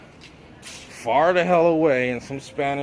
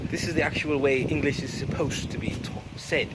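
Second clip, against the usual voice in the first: put-on posh English accent; 3 dB quieter than usual. Shown as a demonstration. Demonstrating the actual way English is said, posh accent. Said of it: "a little bit more posh".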